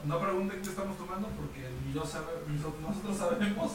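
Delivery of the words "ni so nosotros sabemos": ordinary delivery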